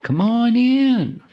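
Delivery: very high, slow, drawn-out Texas twang; Texas drawl. Retold or imitated. Texas accent calling guests in from the back. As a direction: sing out a big Texan welcome. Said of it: "As they say in Texas".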